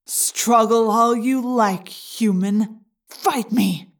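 slow, gloating parasite voice. A narrator's reading. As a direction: savour his helplessness. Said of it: "the Yeerk gloated".

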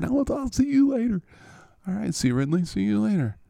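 cheerful receiver thanking the podcast host; goofy high dude voice. Acted. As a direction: play a giddy, friendly jock. C Ridley voice and host goodbye.

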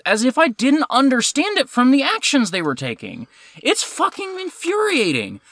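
high-pitched, comically infuriated complaint; exasperated rant. Real, not acted. Ranting about characters explaining their feelings, exasperated.

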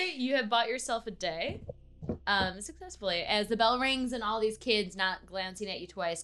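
usual speaking voice

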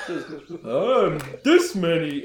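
put-on slow, high little-boy voice; childlike oaf. Big simple son telling adventurers his age, slow childlike voice. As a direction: draw it out loudly like a proud small child. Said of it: "little boy".

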